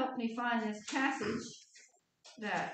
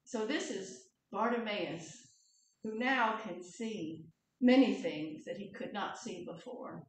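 ordinary talk, nothing marked

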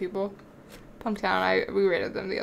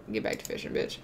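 usual speaking voice

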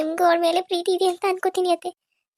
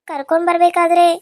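normal talking voice

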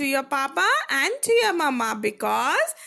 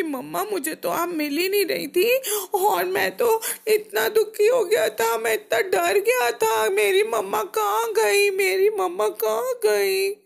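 put-on high, sustained tearful child's whine; acted crying; 5 semitones higher than usual. Bruno tells mama how scared he was, crying. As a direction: wail it all through like a sobbing child. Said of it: "रो रो के बोला".

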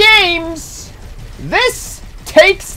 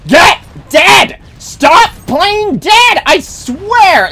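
raging gamer; 6 semitones higher, slower than usual. Real, not acted. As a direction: bark at the enemy to die. Yelling at enemy.

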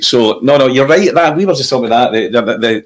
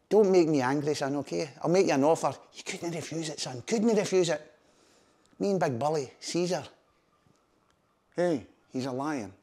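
quiet mock menace; 13 dB quieter than usual. Bertie voiced, quiet menace.

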